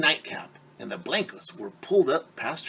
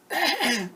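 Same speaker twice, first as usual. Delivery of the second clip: high throat clearing. Acted. Wolf prepares granny voice.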